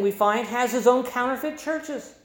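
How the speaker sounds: ordinary talk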